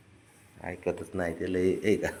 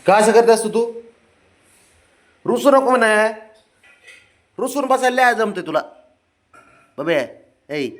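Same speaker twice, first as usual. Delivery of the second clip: coaxing pet talk; 11 semitones higher, 6 dB louder than usual. Real, not acted. Owner coaxes his sulking dog not to sulk, high sing-song baby talk. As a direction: coax a sulking pet to cheer up.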